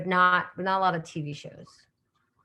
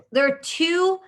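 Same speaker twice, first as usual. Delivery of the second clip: surprised; 8 semitones higher, 5 dB louder, slower than usual. Real, not acted. Surprised at chat.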